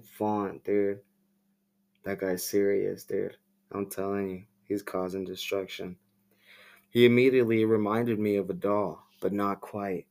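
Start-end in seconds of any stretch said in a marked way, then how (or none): none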